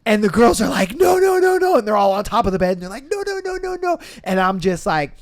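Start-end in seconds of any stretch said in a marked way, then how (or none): 0.9-1.8 much higher, louder frightened no's; high panicked pleading; panicked shriek. Retold or imitated. Girls panicking on bed. 3.1-4.0 put-on panicked girls.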